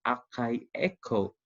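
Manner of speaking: akaiko in drawn-out parts, A and I sounded apart; slow akaiko, vowels kept as two syllables; separated vowels. Shown as a demonstration. Teacher reading a word with diaeresis, vowels pronounced separately. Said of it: "this is not one syllable, but it is two".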